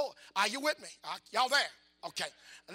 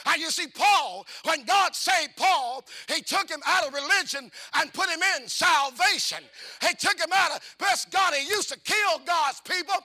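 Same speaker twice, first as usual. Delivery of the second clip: preaching at full force; 4 semitones higher, 3 dB louder than usual. Acted. Preacher calling out salvation to the church, loud and rousing. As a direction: preach it out to the congregation, building.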